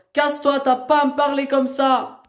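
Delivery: high, furious outburst; angry rebuke. Real, not acted. Young woman rebuking the educator, angry. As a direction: send them off, outraged. Real quarrel, rebuking him.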